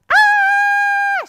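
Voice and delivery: shrill high shriek, full force; piercing child's scream; terrified scream. Acted. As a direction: scream like a kid who saw a werewolf. Paula screaming from the werewolf.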